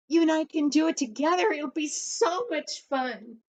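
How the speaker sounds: put-on a boy bursting to help his friend; excited. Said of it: "Jimmy was so excited".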